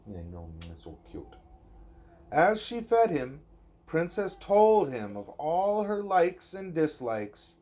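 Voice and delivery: lilting bedtime-story read-aloud; reading to a child. Acted. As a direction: read it gently to a little one at bedtime. Reading a picture book aloud.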